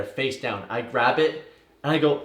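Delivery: ordinary talk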